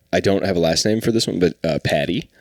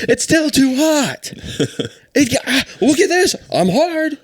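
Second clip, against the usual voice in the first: much higher, slow squeaky mock voice; high mock cartoonist; 3 dB louder than usual. Cartoonist ogling the drawing, high slow mocking squeak. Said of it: "he'd be like".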